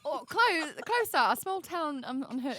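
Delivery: high, loud flustered bluff; mock-panicked English-accent hedging; retold evasive answer. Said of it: "I was like".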